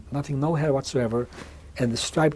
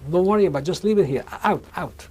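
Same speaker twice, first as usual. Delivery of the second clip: higher, louder, dismissive command; retold soldier's order; 5 semitones higher, 6 dB louder, faster than usual. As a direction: cut them off with an imitated shout. Soldier cuts off the protest with orders.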